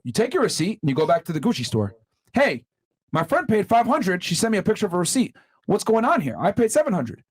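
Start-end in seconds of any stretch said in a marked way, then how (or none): 2.3-7.2 higher, demanding answers; indignant customer. Retold or imitated. Back at the Gucci store, questioning the clerk indignantly. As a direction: push the clerk, offended and confused.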